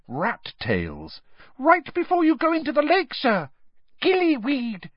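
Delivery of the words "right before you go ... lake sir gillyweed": high, earnest elf squeak; very high-pitched little elf; Dobby. A narrator's reading. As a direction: squeak instructions eagerly. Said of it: "squeaked the elf".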